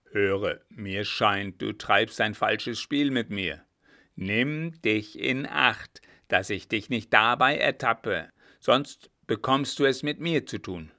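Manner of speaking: loud, weighty warning; stern threat. Acted. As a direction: warn him off with measured force. Captain threatens coachman. (drohend, laut und nachdrücklich)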